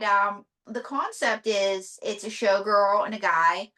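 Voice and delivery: usual speaking voice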